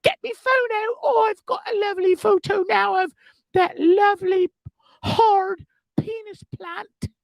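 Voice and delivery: very high, hammed-up English lady; English accent, mum impression. Proud lady up the ladder wanting a photo, high mock English accent. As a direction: gush in a piercing comic English falsetto. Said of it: "Sound like my mom".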